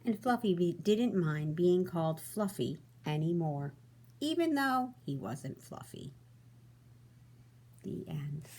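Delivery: storybook read-aloud, expressive and even; reading to children. A narrator's reading. Teacher reading to her class, storybook voice. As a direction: read it like bedtime story time.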